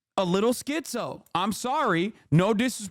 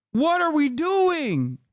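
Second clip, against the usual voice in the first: high, drawn-out incredulous question; exasperated disbelief; 6 semitones higher, 3 dB louder, slower than usual. Real, not acted. Streamer baffled by transphobes' logic, exasperated. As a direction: plead slowly, high with exasperation.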